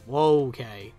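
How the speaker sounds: ordinary talk, nothing marked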